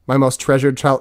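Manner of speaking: usual speaking voice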